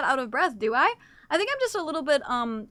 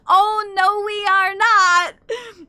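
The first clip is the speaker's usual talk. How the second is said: high, loud, drawn-out denial; loud protest; 6 semitones higher, 9 dB louder, much slower than usual. Real, not acted. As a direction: deny it loudly, stretching the words. Protests being under one hour.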